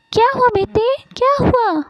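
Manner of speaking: high, anxious motherly questioning; high-pitched fretful mum; alarmed mother. Acted. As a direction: ask urgently, worried for your child. Mother runs to her crying son.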